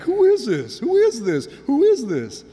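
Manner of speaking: high, insistent disbelief; astonished. Acted. Disciples wondering at Jesus, astonished. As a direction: repeat it in high-pitched amazement, punching each one.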